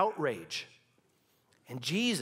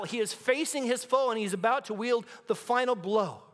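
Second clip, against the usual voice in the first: building preaching; 5 semitones higher than usual. Acted. Preacher rallying the congregation, building intensity. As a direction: preach it like a war horse readying for battle.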